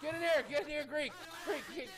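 high, loud repeated calls; frantic game-panic yelling; urgent shouting. Pretend. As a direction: holler for a teammate to come. Calling teammate in urgently.

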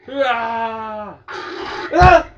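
real frightened shrieks; scared scream. Real, not acted. Jump scare.

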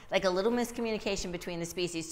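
normal talking voice

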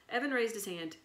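usual speaking voice

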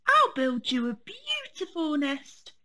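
lightly voiced eager bear cub; lightly voiced bear cub promising a nest; little bear voice. Fred the bear cub speaks.

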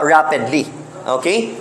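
ordinary talk

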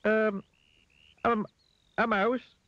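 very high, hesitant little voice; Mister Dizzy. A narrator's reading. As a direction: squeak it out, unsure of the answer. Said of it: "said Mister Dizzy".

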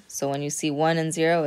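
usual speaking voice

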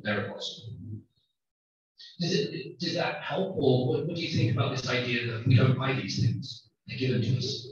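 ordinary talk, nothing marked

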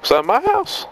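sudden high surprised question. Real, not acted. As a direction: squeak up in surprise. Surprised at house.

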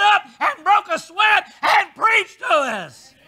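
rising to a loud, high preaching shout; full-force preaching. Acted. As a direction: whip the congregation up until you're shouting.

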